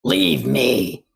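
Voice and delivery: put-on low, loud, mean old grandma. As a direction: snap it like a cranky grandma. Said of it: "That was my, that was my grandma voice, my mean grandma voice".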